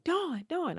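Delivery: very high calling of her name. Retold or imitated. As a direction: call across the track. Someone calling her name from afar.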